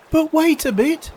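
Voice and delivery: very high little-creature voice; crying oysters. Acted. Oysters asking walrus to wait, squeaky protest. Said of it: "the oysters cried".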